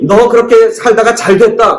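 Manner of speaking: high-pitched scolding in God's imagined voice. Acted. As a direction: scold with biting reproach. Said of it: "아주 혼을 내키든지 야단을 치든지". (야단치듯 높은 목소리로)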